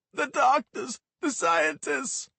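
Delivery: high, loud, slow panicked cry; terrified wail. Acted. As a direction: drag out the fear of them. Paranoid kid in tears.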